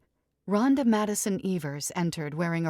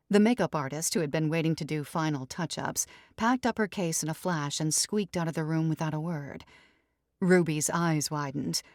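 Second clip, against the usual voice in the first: plain reading voice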